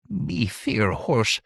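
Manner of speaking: very slow, timid creature voice; downcast satyr. A narrator's reading. Satyr admits his fear to the Count, downcast. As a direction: confess it slowly, downcast. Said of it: "The satyr looked downcast".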